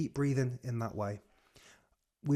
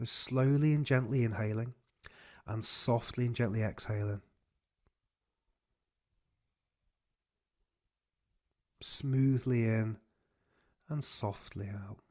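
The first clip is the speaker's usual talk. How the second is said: guided breathing cue. Acted. Coach leads breathing exercise.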